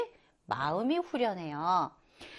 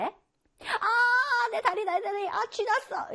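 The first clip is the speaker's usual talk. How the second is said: cramp pain; 12 semitones higher, slower than usual. Acted. Acts out leg cramp pain.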